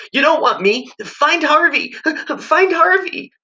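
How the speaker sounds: put-on high teary begging child; whining sob. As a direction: whine through tears like a snotty kid. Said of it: "Wendell whined, all snot and tears".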